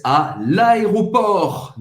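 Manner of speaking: a l'aéroport said very slowly, final T silent; a l'aéroport, slow and clear, no T at the end; slow French model word. Shown as a demonstration. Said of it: "Você não vai pronunciar o T no final".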